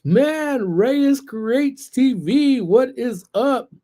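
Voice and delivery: big welcome shoutout; excited shoutout. Real, not acted. Stream shoutout greeting.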